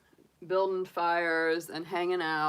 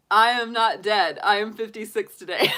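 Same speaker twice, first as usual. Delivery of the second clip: laughing; 2 semitones higher, 6 dB louder than usual. Retold or imitated. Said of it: "he titles the video".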